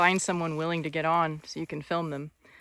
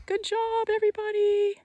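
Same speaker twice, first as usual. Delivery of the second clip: much higher sing-song praise for the horses; 14 semitones higher than usual. Real, not acted. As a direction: lift way up and coo the praise.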